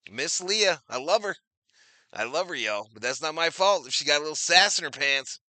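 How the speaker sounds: usual speaking voice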